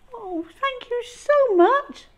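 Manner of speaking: very high, thankful voice; grateful dinosaur. Acted. Dinosaur thanking mum, very high and grateful. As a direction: gush your thanks. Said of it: "said the Super Swooper".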